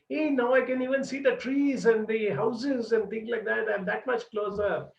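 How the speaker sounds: high, bright hopeful calling; hopeful swimmer. Retold or imitated. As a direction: lift the voice as the coast appears. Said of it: "and she said".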